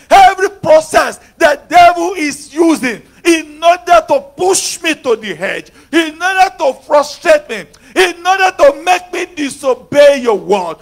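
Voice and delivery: loud, ringing prayer hammered out line by line; preaching at full force. Real, not acted. Preacher leading the congregation in warfare prayer, full force. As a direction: pray it like a battle cry against the devil.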